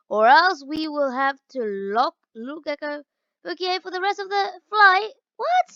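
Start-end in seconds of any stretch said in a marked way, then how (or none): 5.4-5.7 high, sharp outraged rise; a jolted, disbelieving what; shocked what. Real, not acted. Gamer shocked at being threatened with lockup, high outraged what.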